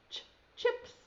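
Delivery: normal talking voice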